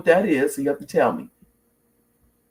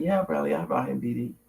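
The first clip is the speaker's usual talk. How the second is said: softer, understated retold answer; matter-of-fact reply; 6 dB quieter, faster than usual. Mom answering her son's casual question, matter-of-fact.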